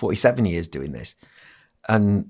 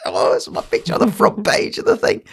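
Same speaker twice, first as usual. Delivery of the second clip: gushing, much higher pitch, breathless; breathless thrilled squeal; excited. Retold or imitated. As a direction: squeal it like a thrilled kid seeing his own photo. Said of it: "I still used to get excited".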